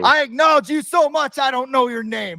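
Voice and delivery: high, hammed-up adoring proclamation; mock-grand declaration. Host professing devotion to a player he misnamed, high mock-grand wail. Resumes mock tribute after name slip.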